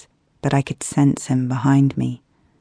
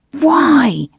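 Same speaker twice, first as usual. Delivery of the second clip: sharp, much higher angry cry; fuming; 12 semitones higher, 4 dB louder than usual. Acted. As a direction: let the frustration burst out in one word. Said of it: "I fumed".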